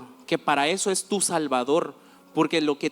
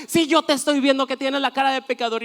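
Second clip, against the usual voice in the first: much higher, teasing; mock accuser; 9 semitones higher than usual. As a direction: imitate the couple teasing her, pitched up. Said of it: "decían". (voz burlona muy aguda)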